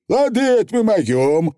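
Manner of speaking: high, cocky little Feegle; squeaky, eager pixie chief; high cheerful Feegle boast. Acted. Feegle voiced by reader.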